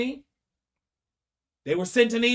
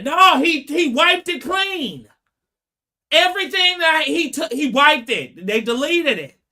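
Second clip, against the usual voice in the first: insistent mock yell; 6 semitones higher, 4 dB louder than usual. Mother insists to the prosecution.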